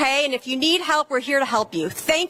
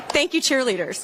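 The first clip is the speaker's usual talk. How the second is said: laughing; 2 semitones lower than usual. Real, not acted. Laughing after crowd chant.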